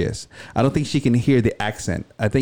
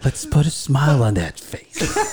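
slow, higher-pitched Dark Knight villain impression; Heath Ledger; 4 semitones higher, slower than usual. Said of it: "listen to the Heath Ledger".

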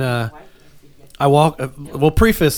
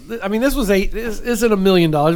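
ordinary talk

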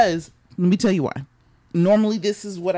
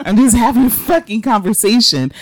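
loud, slow, emphatic exasperation ending in a groan; exasperated rant; 3 semitones higher, 5 dB louder than usual. Real, not acted. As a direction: vent it loudly, word by word. Hot flash rant, exasperated.

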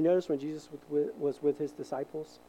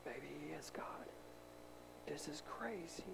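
stunned whisper to each other; awed whisper; 20 dB quieter than usual. Retold or imitated. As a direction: breathe it out in disbelief. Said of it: "looking at each other like".

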